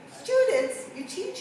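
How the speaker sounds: high, startled query; surprised question. Retold or imitated. Skeptic asking the psychic about his students, surprised. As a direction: feign surprise at the word. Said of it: "and I said".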